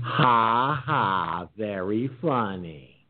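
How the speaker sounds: slow, dry fake laugh; deadpan mock amusement; sarcastic laugh. Real, not acted. Mocking reply to a joke.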